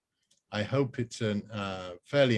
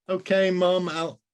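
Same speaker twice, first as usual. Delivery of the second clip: put-on higher, louder girl; young girl's voice; 8 semitones higher, 5 dB louder than usual. Rose apologising to her mum, high and breezy. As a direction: brush it off like a teenager.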